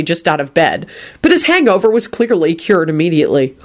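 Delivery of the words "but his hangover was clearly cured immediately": usual speaking voice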